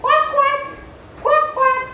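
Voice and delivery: very high-pitched duck quacks; playful squeaky ducky voice. Shown as a demonstration. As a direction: quack like a little duck for children. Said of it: "what does the duck say?".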